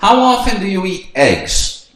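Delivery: slowed classroom English; slow model question. Shown as a demonstration. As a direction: ask it slowly. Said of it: "na segunda aqui". (pergunta lenta e clara)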